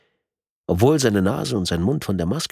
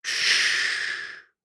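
soft, voiceless shushing; whispered shush; breathed hush; 3 dB quieter than usual. A narrator's reading. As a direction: breathe a long shh at the victim. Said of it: "hauchte der Mann".

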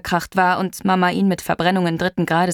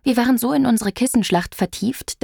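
ordinary delivery, nothing marked

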